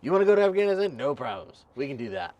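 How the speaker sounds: high, loud, breezy and overeager; eager recruiter voice. Retold or imitated. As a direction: play a chirpy salesman recruiter. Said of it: "the Army's like".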